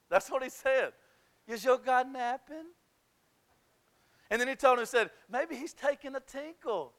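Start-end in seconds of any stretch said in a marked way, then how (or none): 1.5-2.7 mock-innocent taunt; lightly mocking, retold taunt; mocking question. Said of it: "He's making fun of him". 5.3-6.9 softly teasing taunt; mock-innocent suggestion, kept quiet; making fun. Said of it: "He's making fun of him".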